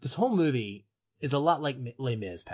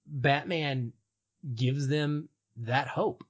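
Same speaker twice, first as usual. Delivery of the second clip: ordinary talk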